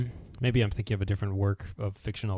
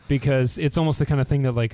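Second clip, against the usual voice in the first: usual speaking voice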